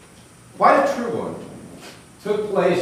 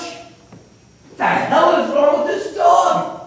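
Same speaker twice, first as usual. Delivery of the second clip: high, loud, slow exasperated outburst; exasperated mailman; 12 semitones higher, 6 dB louder, slower than usual. Acted. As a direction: let the mailman lose patience, slowly and loud. Mailman exasperated at crying dog.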